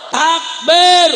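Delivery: high, very loud rallying shout; real full-force pulpit shout; takbir call. Real, not acted. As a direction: call the crowd to respond at full voice. Calls crowd to chant.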